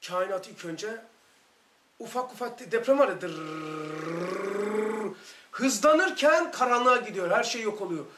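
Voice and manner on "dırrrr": rolled rumbling trill, slow; earthquake rumble. Retold or imitated. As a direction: make the ground shake with a rolled rrr.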